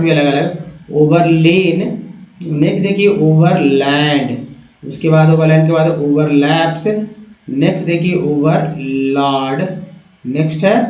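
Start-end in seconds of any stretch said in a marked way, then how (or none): none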